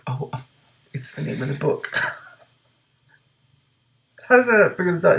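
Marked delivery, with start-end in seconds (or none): 4.3-5.2 loud, high cry of realization; sudden realization. Real, not acted. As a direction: exclaim it as it finally clicks. Reader realizes the book's title.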